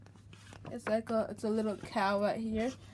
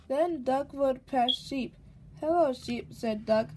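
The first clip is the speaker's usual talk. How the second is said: lively, louder storybook read-aloud; reading to a child; 3 semitones higher, 6 dB louder than usual. A narrator's reading. Mother reading a picture book to her baby, lively storybook voice. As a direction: read it out brightly for the little one.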